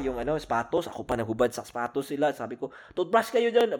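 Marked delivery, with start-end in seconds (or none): 2.9-3.8 higher, louder scolding mom voice; mock angry mother. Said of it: "Parang galit na nanay".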